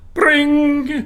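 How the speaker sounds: high, drawn-out ringing noise; imitated phone ring. Storyteller mimics the family phone ringing, high imitated ring. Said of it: "our phone rings".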